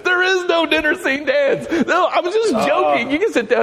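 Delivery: high laughing mock yell; retold yelling. Friend yells at the standing crowd that he joked, high laughing yell. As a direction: shout it high, cracking up. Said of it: "Ed is just yelling at him".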